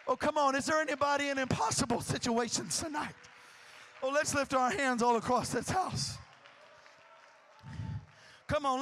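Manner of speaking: usual speaking voice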